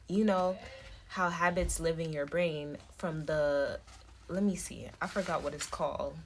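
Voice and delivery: ordinary talk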